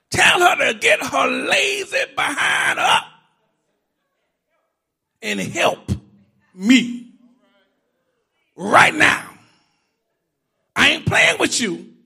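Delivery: put-on loud, high sassy sister girl bossing. Martha as sister girl bossing the Lord around, loud and sassy. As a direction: neck rolling, lips popping, give the Lord attitude. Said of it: "Marcia turned into sister girl".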